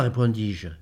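ordinary delivery, nothing marked

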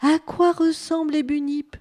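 high, loud, eager asking; urgent question. A narrator's reading. Bunip runs after emu asking, urgent.

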